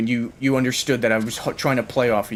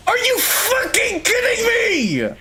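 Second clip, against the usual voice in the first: a long, drawn-out high scream of rage; furious shout; 4 dB louder, slower than usual. Real, not acted. Gamer screaming at a teammate who got him killed, furious. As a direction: scream it out, stretched and cracking high.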